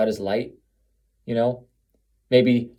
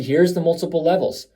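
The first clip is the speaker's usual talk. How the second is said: ordinary talk, nothing marked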